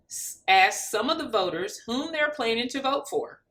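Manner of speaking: plain reading voice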